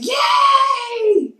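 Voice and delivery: high excited cheering cry; universe cheering. Pretend. The universe cheering the speaker on, high excited cry. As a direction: cheer like the whole universe is celebrating you. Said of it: "the universe is screaming at you".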